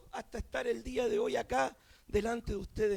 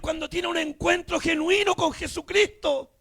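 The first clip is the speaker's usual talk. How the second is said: loud, driving Pentecostal preaching; fervent shouted sermon climax; full-force preaching; 3 semitones higher, 8 dB louder than usual. Real, not acted. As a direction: proclaim it in mounting shouts to a hallelujah.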